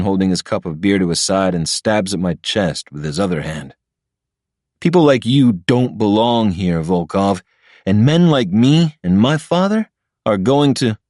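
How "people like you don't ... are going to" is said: higher, lightly voiced entitled threat; arrogant rival. Patrick jabbing Ilya's chest, a hint of a rich bully. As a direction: poke at him with every word.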